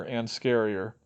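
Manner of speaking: ordinary delivery, nothing marked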